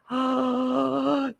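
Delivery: long, drawn-in gasp; suspense gasp. Pretend. Host picking a winning card, suspenseful gasp. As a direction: gasp as you pick the card.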